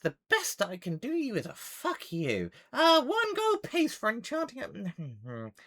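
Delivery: high-pitched gabbling gnome haggler; dismissive gnome. Acted. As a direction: rattle off blahs, then refuse with scorn. Gnome haggles and refuses offer.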